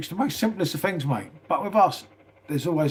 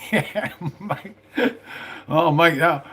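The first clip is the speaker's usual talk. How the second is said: laughing it out, pitched up; 4 semitones higher, much slower than usual. Real, not acted. Laughing at his own camping joke to viewers. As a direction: chuckle through the words. Laughs after carry on line.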